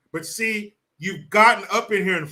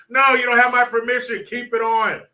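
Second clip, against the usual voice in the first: louder, higher imagined crowd shout; mock heckler; 6 semitones higher, 6 dB louder than usual. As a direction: holler back from the crowd in mock protest.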